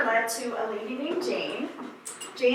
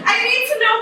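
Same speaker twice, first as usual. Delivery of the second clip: nervous laugh; 10 semitones higher, 5 dB louder, faster than usual. Real, not acted.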